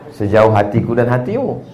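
ordinary talk, nothing marked

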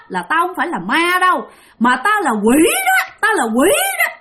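high-pitched, confrontational ghost woman; menacing demon woman. Retold or imitated. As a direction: challenge a trespasser as an angry demon. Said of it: "Cái bà này bà lướt lại". (giọng bà quỷ hăm dọa, cao gắt)

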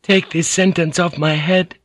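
high-pitched humble plea; meek, high feminine supplication; pleading. A narrator's reading. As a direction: beg your lord in a high voice. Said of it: "she pleaded".

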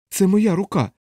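high, impassioned insistence; heated. Acted. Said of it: "запально вигукнув капелан".

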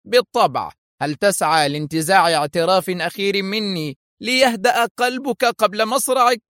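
lightly voiced gloating spy; sarcastic villain. A narrator's reading. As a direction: taunt the captive with smug scorn. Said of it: "ضحكة ساخرة".

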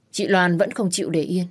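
usual speaking voice

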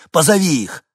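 loud, high command; urgent order. Acted. Срочная команда матери. (громкий срочный приказ)

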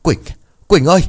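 loud, high cry of her name; father calling after his fading daughter. A narrator's reading.

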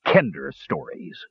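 breathy, scornful dwarf's snort. A narrator's reading. Flint dismisses Tass's tale, a scornful snort. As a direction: huff it out dismissively. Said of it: "he snorted".

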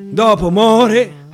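ordinary delivery

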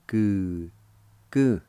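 two separated, held syllables. Shown as a demonstration. Teacher showing learners the word jar, syllable by syllable. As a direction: sound out each syllable firmly on its own. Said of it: "It's pronounced like this".

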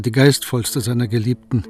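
ordinary delivery

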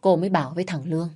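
usual speaking voice

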